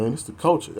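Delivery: mocking old saggers. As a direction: parrot the lame excuse back at them. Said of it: "talking about".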